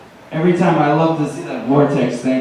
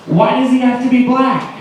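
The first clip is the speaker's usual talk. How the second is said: indignant hippie; 8 semitones higher than usual. Retold or imitated. Hippie girl confronts him.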